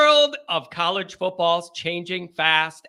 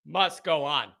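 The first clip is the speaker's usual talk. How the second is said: "must go on" said softer and lower; soft, low finish; 5 semitones lower, 6 dB quieter than usual. Shown as a demonstration. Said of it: "second take, drops soft".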